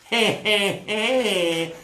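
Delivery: a high mock evil laugh; villain chuckle. King Nachash gloating over the city, mock laugh.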